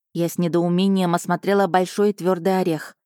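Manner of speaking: ordinary delivery, nothing marked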